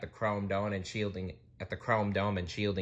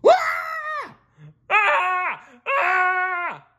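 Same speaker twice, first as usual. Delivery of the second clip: high, loud cartoon howls; piercing, drawn-out "Ah!" screams; howl of pain; 8 dB louder, much slower than usual. A narrator's reading. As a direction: yelp like your pants caught fire. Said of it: "Mister Krabs howled and hopped".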